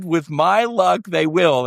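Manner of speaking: With my luck, they will drawn out slowly, high and laughing; laughing wry repeat. Retold or imitated. Repeating the punchline to the host, slow and laughing. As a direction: savour the irony through a laugh. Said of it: "I said".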